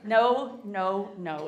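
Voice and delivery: normal talking voice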